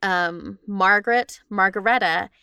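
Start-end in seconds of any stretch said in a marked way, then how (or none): none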